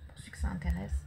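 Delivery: soft whispered aside; whispering. Real, not acted. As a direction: breathe it as an aside.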